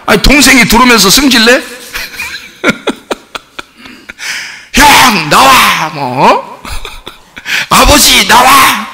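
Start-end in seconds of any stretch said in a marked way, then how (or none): 4.7-8.9 hammed-up high angry call; mock temper. Younger son storming home to brother and father, mock temper. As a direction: put on a tantrum, calling them out. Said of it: "막 승질내면서 들어와요?".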